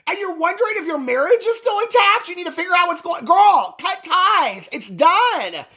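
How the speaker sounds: put-on hollering advice at her like a fed-up girlfriend; sassy exasperated rant. Speaking to Christine on the show, high and exasperated. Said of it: "I'm like".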